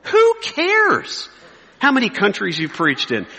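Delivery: high-pitched exclamation of disdain; scornful outburst. Real, not acted. Preacher mocking boasts of successful ministry, scornful outburst. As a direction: cry out in exasperated dismissal.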